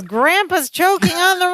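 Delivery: high-pitched retold outcry at normal volume; mock alarm. Recapping Larry's wild conclusion to the co-host, mock alarm. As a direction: imitate a character jumping to a wild conclusion. Acted sitcom panic over Grandpa choking.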